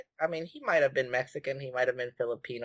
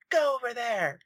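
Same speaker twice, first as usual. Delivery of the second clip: high-pitched barked order, mimicked; mock a pissed-off guard sending someone away; retold yell, pitched way up; imitated angry yell; 10 semitones higher than usual. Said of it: "he just yells at me".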